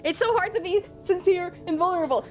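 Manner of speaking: high, rushed, worked-up pleading; exasperated protest. Real, not acted. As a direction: plead to be taken seriously, fast and high. Protests that everybody jokes about it.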